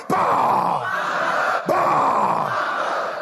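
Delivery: long, low, growled bahs; Scrooge. Acted. Preacher cues the church to finish Scrooge's line, growling. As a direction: draw out a grumpy miser's "Bah!". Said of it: "Don't be a Scrooge".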